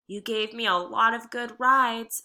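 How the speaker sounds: ordinary delivery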